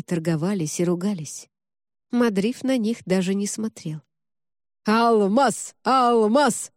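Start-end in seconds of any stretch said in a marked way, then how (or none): 4.8-6.7 crowd howling a chant. A narrator's reading. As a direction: howl the name like a crowd. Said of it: "выла толпа".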